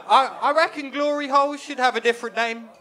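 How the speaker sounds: usual speaking voice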